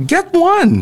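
high insistent dad quote; dad's voice. Retold or imitated. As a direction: push him to buy it.

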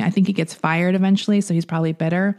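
ordinary talk, nothing marked

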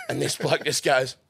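ordinary talk

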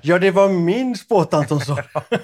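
loud, high, slowed burst through laughter; laughing admission. Real, not acted. Caught out by partner about the quote, laughing loudly. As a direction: own up loudly while cracking up.

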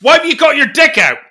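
loud, high mock-outraged yell; outraged shout. Imagined stranger confronting him in the changing room, outraged shout. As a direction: call it out like a scandalised stranger.

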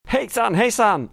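hoarse, worn-out voice; croaky voice nearly gone; voice giving out. Real, not acted. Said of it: "Oh, my voice is almost gone".

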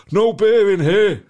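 a dad shouting across an empty pub for beer; loud shout. Retold or imitated. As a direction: bang on the bar and roar it to get served. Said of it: "like that, really loud".

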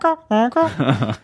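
high squeaky honking; clown honk. Pretend. Imitating clown nose honk.

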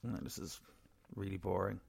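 bored. Retold or imitated. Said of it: "I was like".

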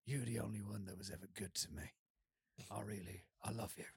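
low, hushed imitation of a film tough guy; film hero's tender line. Retelling the hero's words to the old lady, low hushed impression. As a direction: murmur it low and soft, mimicking the hero. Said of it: "and he's like".

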